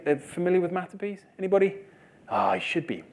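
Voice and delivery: ordinary talk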